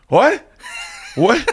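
groggy shock. Retold or imitated. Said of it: "I'm like".

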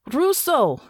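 calling out high across the docks; shout. A narrator's reading. Natasha calling to Russo at the docks, shouting. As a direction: yell her name to stop her. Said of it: "she shouted".

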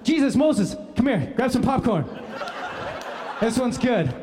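hammed-up God summoning Jesus and Moses; God calling friends. God calling Jesus and Moses to watch, excited shout. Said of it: "God goes".

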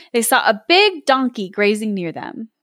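usual speaking voice

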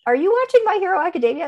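high, quick disbelieving question; a mom asking her kid, high and quick in disbelief; incredulous question. Retold or imitated. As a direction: ask it like you can't believe it.

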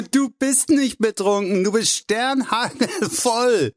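high, laughing outburst, last word drawn out; spluttering with laughter. A narrator's reading. Hugh tells Drew he is plastered, spluttering with laughter. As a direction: crack up while teasing a drunk friend. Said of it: "gab Hugh prustend von sich".

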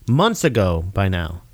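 ordinary talk

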